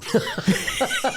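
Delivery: high, delighted cackle; high cackling laugh. Retold or imitated. Reggie laughing at his own joke about death, a high cackle. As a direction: let out a gleeful old-man cackle.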